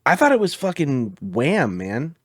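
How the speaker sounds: normal talking voice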